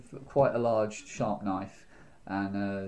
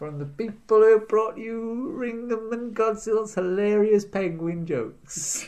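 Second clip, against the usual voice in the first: high, slow promo announcer; mock trailer voice-over; 10 semitones higher, 3 dB louder, slower than usual.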